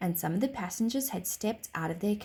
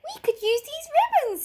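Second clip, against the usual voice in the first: very high, loud doll voice; 7 dB louder than usual. A narrator's reading. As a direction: offer help as a tiny doll. Doll character voice.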